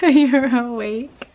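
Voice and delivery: said slowly through a laugh. Real, not acted. Mother laughs, repeating the child.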